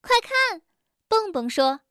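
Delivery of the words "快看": excited call. A narrator's reading. Rabbit points at storks.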